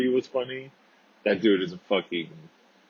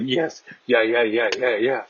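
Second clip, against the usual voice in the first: eager, slightly raised string of yeses; retold excitement; 3 semitones higher, 3 dB louder than usual. Friends offered ice cream, imitated eager agreement. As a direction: imitate being all excited. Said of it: "like, all excited".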